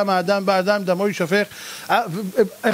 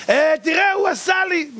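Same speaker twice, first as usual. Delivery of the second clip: whiny excuse; 8 semitones higher than usual. Retold or imitated. Mocks excuse-maker before heavenly court.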